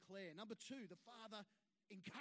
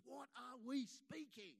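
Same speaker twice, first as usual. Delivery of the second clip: normal talking voice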